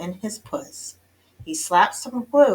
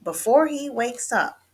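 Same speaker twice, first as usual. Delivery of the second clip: higher, firm warning; motherly order; 6 semitones higher, 3 dB louder than usual. A narrator's reading. Mama warns about the sleeping dog, ordering firmly. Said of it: "ordered Mama".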